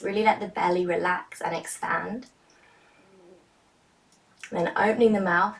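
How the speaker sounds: ordinary talk, nothing marked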